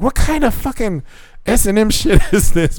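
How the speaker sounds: high, incredulous outburst; high shocked retort, half laughing; mock outrage. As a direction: blurt it out, laughing. His shocked retort retold.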